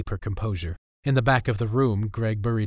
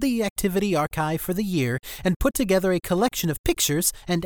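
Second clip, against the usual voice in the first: whiny teacher voice; 6 semitones higher than usual. Acted. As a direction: announce to class, grating and pompous. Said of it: "his whiny voice".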